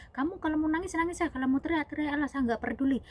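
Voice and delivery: high-pitched, emphatic brushing-off of protests; firm insisting. Retold or imitated. Father figure massaging her sore leg, firm insisting. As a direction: imitate a father who will not relent.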